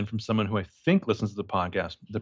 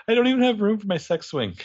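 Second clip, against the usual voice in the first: a much higher, hammed-up voice for the suave guy; mock Gabriel; 10 semitones higher than usual. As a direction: send up the smooth seducer, pitched way up. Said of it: "This guy's like".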